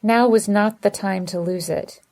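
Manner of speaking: plain reading voice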